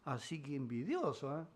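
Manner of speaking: soft, sly, sneering devil voice; the devil gloating quietly over the confession; mocking devil. Devil mocking Luther for confessing his sins, in a sly, soft sneer. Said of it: "Y el diablo".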